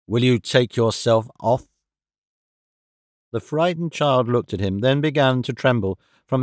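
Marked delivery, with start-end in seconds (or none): 0.1-1.7 low stern man. Acted. Valjean orders the child away.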